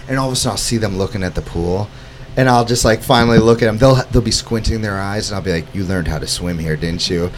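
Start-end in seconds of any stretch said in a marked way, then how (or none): 5.7-7.2 low, softly spoken quiet question; knowing aside. Retold or imitated. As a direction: ask it low and soft, as if quietly guessing their secret. Acted exchange with tour visitors.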